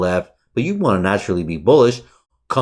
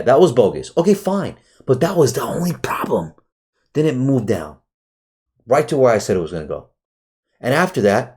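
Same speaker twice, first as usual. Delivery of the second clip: usual speaking voice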